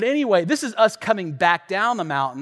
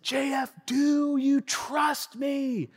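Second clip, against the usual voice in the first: retold yell; 7 semitones higher, 5 dB quieter, much slower than usual. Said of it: "He yelled at me".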